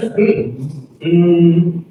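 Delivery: sustained hummed "mm"; throat vibration. Shown as a demonstration. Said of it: "your throat should vibrate".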